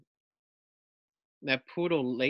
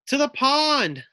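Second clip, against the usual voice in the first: high, loud command; rally the pack with a call; giving an order; 14 semitones higher, 7 dB louder than usual. Acted. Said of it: "orders Buffy".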